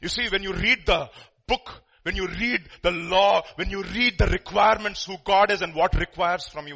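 loud, driving sermon build; preaching at full force. Acted. Preacher pressing the congregation about God's law, loud. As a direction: hammer each line to the congregation.

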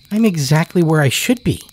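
put-on high, quick child's insistence; child insisting. Child insists to woodcutter, high and quick. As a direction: rattle it off like a sure child. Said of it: "the child insisted".

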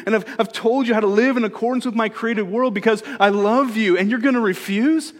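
impassioned plea. Acted. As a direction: build it up like an exasperated parent. Voicing God's exasperated offer.

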